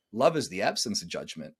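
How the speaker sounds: usual speaking voice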